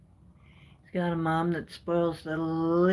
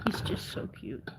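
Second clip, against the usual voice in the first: unvoiced soft whisper; 5 semitones lower, 9 dB quieter than usual. Real, not acted. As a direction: whisper so the cat stays calm. Whispering over the sleepy cat.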